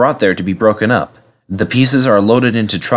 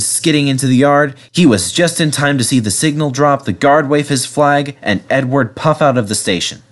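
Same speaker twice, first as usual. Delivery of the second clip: plain reading voice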